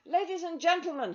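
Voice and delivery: loud call for attention; boy on a chair shouting to the crowd. Acted. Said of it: "he shouted".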